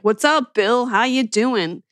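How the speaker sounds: casual, cocky greeting to the president; slangy greeting. Retold or imitated. Player greeting President Clinton like a buddy, casual slangy hello. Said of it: "she used, like, every slang in the book".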